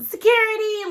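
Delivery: very high-pitched call for help; imitated Karen. Portia calling security like a Karen, imitated Karen. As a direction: mimic a Karen calling out in alarm. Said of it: "she was like a Karen".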